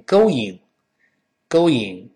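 "going" as go-ing with no w glide; common mispronunciation. Shown as a demonstration. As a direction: say "going" as "go", then a bare "ing". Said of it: "容易把这个词读成".

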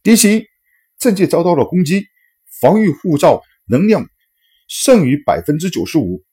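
high loud alarm announcement; alarm shout. A narrator's reading. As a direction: call it like a ship's alarm. Alarm announcing enemy attack.

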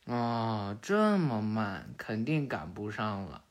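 low, soft, slow resignation; weary grumbling. Acted. Waiting on the rooftop for latecomers, weary grumbling. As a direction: sigh it out, dropping low and quiet.

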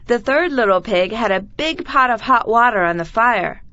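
ordinary delivery, nothing marked

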